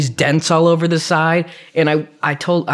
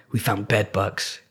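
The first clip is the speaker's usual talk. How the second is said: slow, low, hushed reveal; imitating a subdued, ominous announcement; grave bad news; 5 semitones lower, 7 dB quieter than usual. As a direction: break the bad news quietly and slowly. Friends break bad news, acted.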